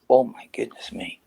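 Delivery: breathy whispered groan of disbelief; whispered dismay. Retold or imitated. Player whispering his dismay at the new manager's talk. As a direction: whisper it like you can't believe your ears.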